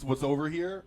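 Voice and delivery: normal talking voice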